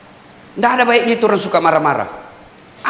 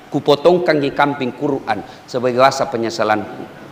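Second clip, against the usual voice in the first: remorseful apology; 6 semitones lower than usual. Pretend. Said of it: "maka bikin kau surat".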